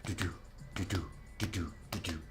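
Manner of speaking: low paired "do-do" thumps; evenly spaced double beats, like a heart; imitated heartbeat. Shown as a demonstration. Host imitating a horror movie heartbeat, low pulsing thumps. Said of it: "like, a heartbeat is like".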